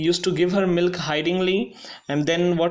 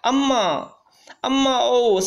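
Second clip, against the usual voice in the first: raised wail; 6 semitones higher than usual. A narrator's reading. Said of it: "Raised his voice".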